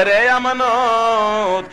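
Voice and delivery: long-drawn chanted call. Acted. Munsif rises and calls Amanat, long-drawn cry. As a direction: stretch the name right out as you call. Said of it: "chair থেকে উইটা ডাকতেছে".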